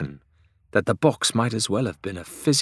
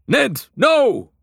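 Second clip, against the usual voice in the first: high, loud cry of alarm; urgent shout; 5 dB louder than usual. A narrator's reading. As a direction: yell it in sudden fear. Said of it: "The cry rang out with such ferocity".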